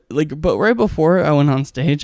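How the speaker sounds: ordinary talk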